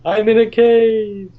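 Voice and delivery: high, slow, booming cave voice; cave echo. Pretend. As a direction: call out as if echoing in a cave. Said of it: "Don't do that".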